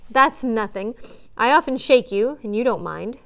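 ordinary delivery, nothing marked

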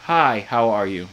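slow, low American accent attempt; careful, drawn-out imitation of an American accent. Shown as a demonstration. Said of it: "That was pretty good".

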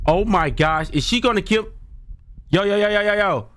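high panicked yelps; panicking, voice way up; scared horror game streamer. Real, not acted.

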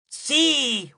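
high, exultant cry; triumphant demon whoop; proud yell. A narrator's reading. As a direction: yell it with pride. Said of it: "urlò uno dei suoi figli con orgoglio".

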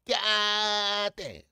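high, long-held holler of admiration; exclamatory shout. Real, not acted. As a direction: holler it, stretching the first syllable. Exclaims over Southern girls.

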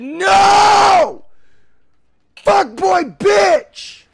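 high, loud outraged yelling; furious shout. Real, not acted. Player who dropped it cursing a rival, furious shout. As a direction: yell it with full force.

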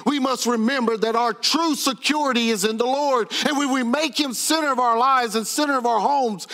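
rising, driving sermon cadence; preaching. Acted. Pastor preaching to his church, building sermon voice. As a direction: preach it out to the congregation.

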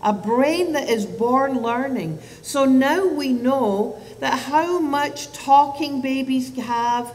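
ordinary delivery

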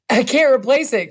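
put-on a touch of an old man's anguish; anguished cry. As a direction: cry out in despair. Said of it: "cried Pa".

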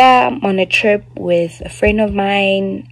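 usual speaking voice

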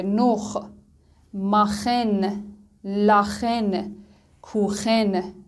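نخ، ماخن، لاخن، کوخن with a clear back-of-throat "kh"; "kh" sound. Shown as a demonstration. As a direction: drill the "kh" slowly, word by word. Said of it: "خ رو ایجاد کرده".

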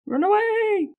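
high, mock-panicked yell of run away; mock cry of retreat. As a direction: shout it like fleeing comic knights. Comic cry echoing the retreat.

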